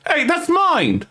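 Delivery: high indignant yelp; shocked protest. Acted. Robbed tiefling yelping in shock that the book is his. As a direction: cry out as the book is snatched. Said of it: "he looks shocked and goes".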